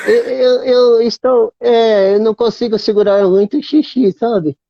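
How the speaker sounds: put-on high, hesitant old puppet voice; fumbling ventriloquist grandpa; Robinson McAllister. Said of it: "Coisa de velho".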